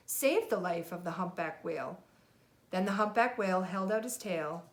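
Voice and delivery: put-on lively read-aloud for a child; storybook reading. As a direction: read it like a bedtime picture book. Parent reads picture book aloud.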